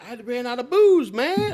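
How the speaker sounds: high, loud, slurred party voice; drunk buddy voice. Retold or imitated. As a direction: play the drunk friend who is proud of his run. Drunk J J answers.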